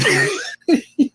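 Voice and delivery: high-pitched giggle breaking out mid-sentence; helpless laugh. Real, not acted. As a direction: burst into a high laugh you can't explain. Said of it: "I don't know why I started laughing".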